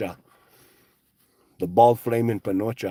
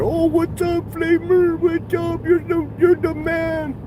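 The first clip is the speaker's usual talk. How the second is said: high, drawn-out fanboy squeal; sucking up in a silly high voice; mocking fawning fans; slower than usual. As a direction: gush in a mock falsetto, stretching the words. Acting out fawning fans' greeting.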